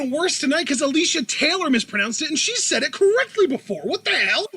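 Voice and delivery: high-pitched, fast, exasperated outburst; indignant rant. Real, not acted. Co-host complains to his partner about a mispronounced name, indignant rant. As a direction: rant in real disbelief, pitch shooting up.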